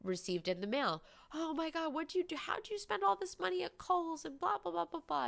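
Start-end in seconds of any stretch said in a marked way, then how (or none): 1.3-5.3 much higher, mock-panicked dad over the bills; frantic father. Imitating her father scolding her mother over Kohl's bills, in a high mock-panicked voice. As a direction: squeal it like an alarmed father waving a receipt. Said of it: "how my father growing up would treat my mother".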